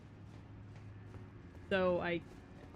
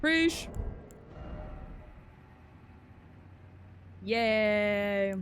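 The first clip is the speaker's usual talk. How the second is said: excited. Real, not acted. Cheerful thanks after item.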